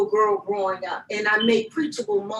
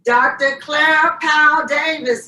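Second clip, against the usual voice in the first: hyped giveaway announcement; 5 semitones higher, 6 dB louder than usual. Real, not acted. Reading winner name.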